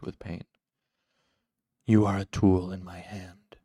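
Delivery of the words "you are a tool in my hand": cool, controlled insistence, louder; cool repetition. A narrator's reading. Shadowed master makes Cinder say it, coolly insistent. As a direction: repeat it coolly and firmly. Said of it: "the cool voice repeated".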